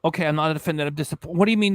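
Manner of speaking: normal talking voice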